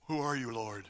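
low, hushed mock-innocent question; acted sheepish murmur, dropped low; meek reply. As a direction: ask it small and quiet. Acts sheepish reply to God.

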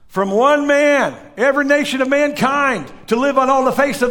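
high, loud, fired-up preaching; preaching at full force. Acted. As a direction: drive it home to the congregation.

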